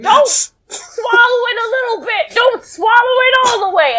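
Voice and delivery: high-pitched, hammed-up desperate pleading; Mister Satan. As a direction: beg in a mock-frantic high voice. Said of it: "he begs at Videl".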